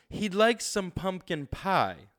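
"pump" and "pie" stressed; "He'd like some pumpkin pie" with "pump" and "pie" slow and strong. Shown as a demonstration. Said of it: "pump and pie are emphasized".

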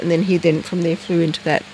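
ordinary talk, nothing marked

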